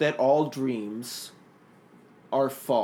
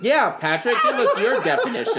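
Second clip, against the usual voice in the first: laughing; 8 semitones higher, 5 dB louder than usual. Real, not acted. Teasing through laughter.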